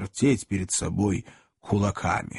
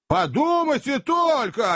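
high, slow indignant appeal; rally the onlookers to your grievance; appealing to crowd; 12 semitones higher, slower than usual. A narrator's reading. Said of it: "апеллировал возница к толпе". (возмущённо взывая к толпе)

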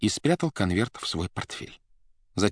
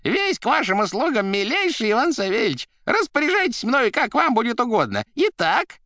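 high, loud, effusively servile patter; crackling chatter; 5 dB louder than usual. A narrator's reading. Assistant offering his services by phone, crackling and high. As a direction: gush in a squeaky telephone voice. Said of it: "трещала трубка".

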